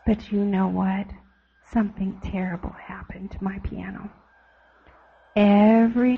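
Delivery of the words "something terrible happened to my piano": put-on hushed storyteller whisper to the kids. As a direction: whisper it like a spooky secret. Dramatic secret to children.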